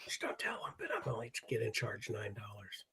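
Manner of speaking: hushed, unvoiced whisper; secret-telling whisper. Co-host pretending to confide a secret about streaming fees, whispering. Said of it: "I like how we're telling a secret".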